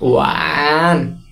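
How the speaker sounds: "ওয়ান" very slowly, stretched through a third-tone dip. Shown as a demonstration. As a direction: draw "ওয়ান" right out to show the tone. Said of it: "এটা উচ্চারণটা হচ্ছে". ("ওয়ান" তৃতীয় টোনে লম্বা করে টেনে)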